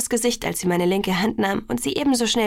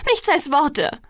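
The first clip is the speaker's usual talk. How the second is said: higher, indignant retort; upset; 6 semitones higher, 4 dB louder, slower than usual. A narrator's reading. Alice rejects her friend's assurance, upset. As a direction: throw it back, worked up. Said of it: "sagte sie aufgebracht".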